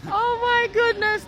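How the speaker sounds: high, slow, delighted cry. Real, not acted. After the young man's patois answer, delighted high cry. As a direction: gush it out, charmed.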